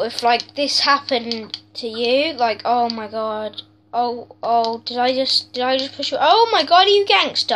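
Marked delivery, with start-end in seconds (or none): 6.2-7.5 mock outrage. Mocking NPC who pushed him.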